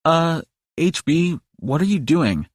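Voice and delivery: slow, high, lightly voiced puzzled Liz; hesitant and puzzled, drawn out; puzzled question. A narrator's reading. Liz asking Hellboy what he's doing, puzzled and high. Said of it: "Liz asks".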